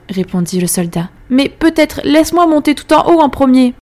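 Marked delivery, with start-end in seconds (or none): 1.3-3.7 soldier voice. A narrator's reading. Soldier bargaining with old woman.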